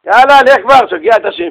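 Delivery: high, loud mock nag of a wife; impatient nagging. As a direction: shoo the husband out impatiently. Impatient wife nags her husband. (חיקוי אישה מנדנדת בחוסר סבלנות)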